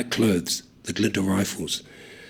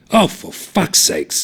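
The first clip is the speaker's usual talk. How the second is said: exasperated; 9 semitones higher, 5 dB louder, slower than usual. A narrator's reading. Thomas exasperated.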